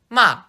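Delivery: "ma" falling sharply from high, Mandarin fourth tone. Shown as a demonstration. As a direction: snap "ma" down from the top. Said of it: "the fourth tone goes down".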